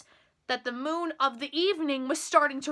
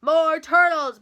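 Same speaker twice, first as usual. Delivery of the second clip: loud bellowing command; bellowed order; 9 dB louder, slower than usual. A narrator's reading. King ordering up more turtles, loud bellow. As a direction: bellow it out to the whole pond. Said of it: "he bellowed and brayed".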